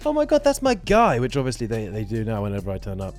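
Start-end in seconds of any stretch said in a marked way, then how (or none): 0.1-1.2 high, loud gushing fan voice; excited fan. Retold or imitated. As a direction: squeal it like a thrilled friend. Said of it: "it would be like".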